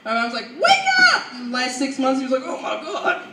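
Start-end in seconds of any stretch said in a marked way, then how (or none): 0.6-1.3 very high, loud wake-up holler; imitating mom shouting; mock mom yell. Mom rousing sleeping dad. 2.4-3.2 higher mock bewildered dad; dad jolted awake. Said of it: "completely knocked out".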